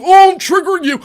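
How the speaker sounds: mocking her taunt with a loud, high jeer; mock shout. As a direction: yell it as a taunt. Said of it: "She just says".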